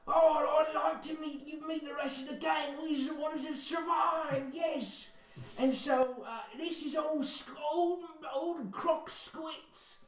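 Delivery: a small lizard proudly showing off survivors; high lizard-folk voice. Acted. Ratley introducing the tribe, high and proud.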